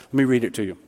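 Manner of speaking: usual speaking voice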